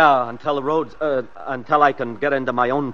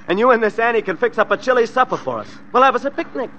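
higher, sneering sing-song; mocking gloat; 6 semitones higher than usual. Killer mockingly promises the family a picnic, sneering. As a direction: taunt them with fake cheer.

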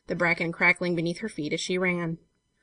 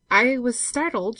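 slow, slightly raised voice; slow admission; 6 semitones higher, 4 dB louder, slower than usual. A narrator's reading. Girl recovering with hands on his shoulder, slowly. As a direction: draw the words out slowly. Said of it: "she said slowly".

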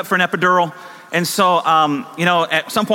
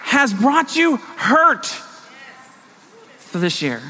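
rousing pulpit exhortation, pitched high; impassioned preaching; 7 semitones higher than usual. Real, not acted. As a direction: preach it with rising urgency, stretching the last phrases. Preaching at full force.

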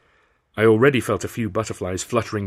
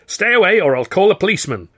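angry threat; 9 semitones higher, 7 dB louder than usual. A narrator's reading. Woman threatening stranger.

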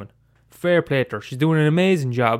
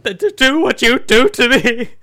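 anguished, loud and high accusations; hammed-up furious betrayed pet; aggrieved talking dog; 6 dB louder than usual. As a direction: build from hurt to a wailing tirade.

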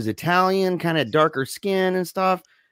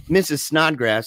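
ordinary delivery

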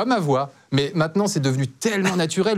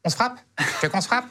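higher-pitched, comically unthreatening challenge; real voice returning; 5 semitones higher than usual. Acted. Man challenging a harasser as his voice slips, higher mock threat. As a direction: pick a fight in a voice that betrays you. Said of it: "ta voix revient".

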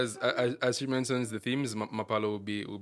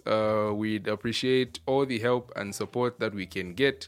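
loud, upbeat sponsor shout-out; ad read; 8 dB louder than usual. Acted. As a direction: announce it like a radio ad. Podcast sponsor break segment.

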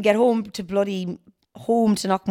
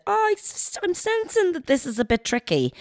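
high tactful; 12 semitones higher than usual. Retold or imitated. Her own tactful reply retold.

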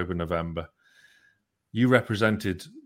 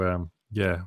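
usual speaking voice

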